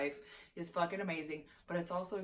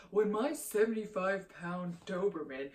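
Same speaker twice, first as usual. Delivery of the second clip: lightly put-on voice, mocking her prim apology. Vlogger quoting the YouTuber's apology, lightly mocking. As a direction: read her excuse back with a light sneer. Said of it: "she was like".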